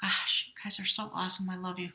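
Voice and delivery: soft, choked-up whisper; tearing up. Real, not acted. Reader moved to tears by the Scorpio spread, softly choked. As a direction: hold back tears as you say it. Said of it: "Oh, now I'm gonna cry".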